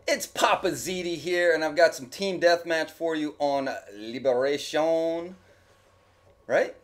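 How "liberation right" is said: map name said in a light mock French accent; a quick put-on French accent on Liberation. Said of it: "with a French accent".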